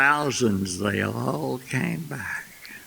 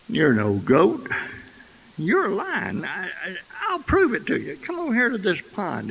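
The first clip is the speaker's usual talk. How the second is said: higher-pitched, lightly acted insistence; a storyteller's exasperated big lion; insistent lion; 5 semitones higher than usual. Lion sets out to prove it to the cub, mildly exasperated.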